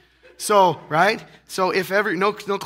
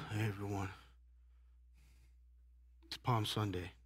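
mock sleepy greeting; 9 semitones lower, 17 dB quieter than usual. Mocking a listless, sleepy crowd.

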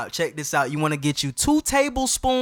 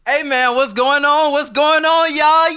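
loud, high hype-man greeting; shouted, high podcast welcome; hyped greeting; 9 semitones higher, 8 dB louder than usual. Real, not acted. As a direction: fire up the crowd.

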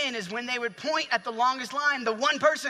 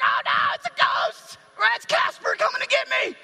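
high, loud hammed-up panic; mock-hysterical screaming; freaking-out scream; 6 dB louder than usual. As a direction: shriek like terrified grown men losing it. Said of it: "They're freaking out".

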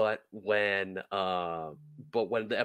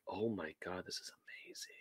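hushed, imitated amazement trailing into a whisper; awed whisper; 13 dB quieter than usual. Player reliving hearing the dungeon master's edits, awed whisper. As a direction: breathe it out in quiet wonder. Retells awed reaction to the editing.

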